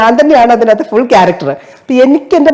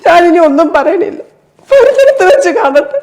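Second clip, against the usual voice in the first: higher pitched, pleading and near tears at the airport counter; desperate stranded passenger; 4 semitones higher than usual. Acted. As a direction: beg the staff, voice cracking into sobs. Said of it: "ഒന്ന് ഞാൻ അവതരിപ്പിക്കാനായിട്ട് try ചെയ്യാവേ".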